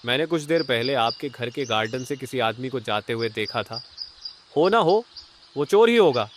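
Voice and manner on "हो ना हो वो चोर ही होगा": high and loud, sure it was the thief; excited certainty. Acted. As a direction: blurt out the suspicion eagerly. Neighbour insists it was the thief. (उत्साह से ऊँची और तेज़ आवाज़ में)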